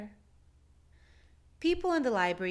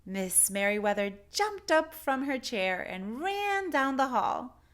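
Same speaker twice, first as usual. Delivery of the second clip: ordinary delivery, nothing marked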